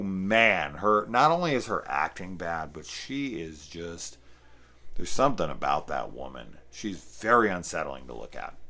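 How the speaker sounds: ordinary delivery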